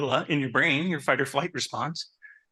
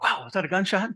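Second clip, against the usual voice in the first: startled; 6 semitones higher than usual. Retold or imitated. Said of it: "I'm like".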